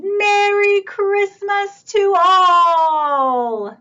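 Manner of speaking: loud, slow, high festive shout; big slow jolly shout. Acted. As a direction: call it out to the whole farm. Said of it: "Then he shouted".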